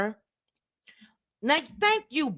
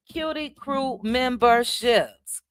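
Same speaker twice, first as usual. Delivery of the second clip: slow, stretched-out syllables; drawn-out announcement; 3 semitones higher, slower than usual. Real, not acted. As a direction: announce each word slowly, letting it linger. Name drawn out, then repeated normally.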